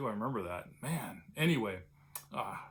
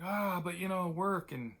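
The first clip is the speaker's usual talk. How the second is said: mock protest; 7 semitones higher than usual. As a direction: plead your case, pitched up. Answering doctor's advice.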